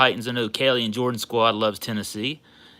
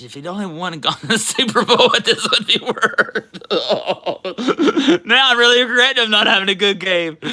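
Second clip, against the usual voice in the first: laughing; 7 semitones higher, 4 dB louder than usual. Real, not acted. Laughing regret.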